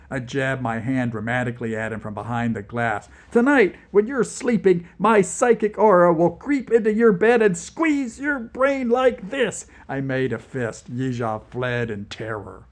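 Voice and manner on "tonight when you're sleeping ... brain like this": high, loud theatrical threat; mock menace. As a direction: threaten him like a stage villain. Nikita threatening the interrogator.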